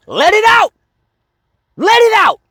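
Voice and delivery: high, loud yell of release; yelled command. Shown as a demonstration. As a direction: shout it out like a coach. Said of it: "I literally want you to just fucking scream".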